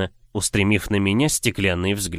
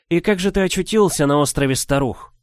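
higher, eager questioning witch; quick sharp witch; 7 semitones higher than usual. A narrator's reading. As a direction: jump in sharply. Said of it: "Сразу же подхватила она". (резко подхватывая)

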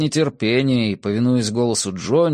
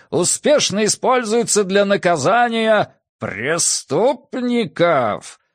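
booming circus ringmaster; 10 semitones higher than usual. A narrator's reading. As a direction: cry it out like a fairground showman. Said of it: "закричал во всё горло".

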